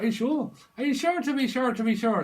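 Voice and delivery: high, loud mock Irish accent. Imitating someone from Ireland, exaggerated Irish accent. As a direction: ham up a stage Irish lilt. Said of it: "Someone could be from Ireland".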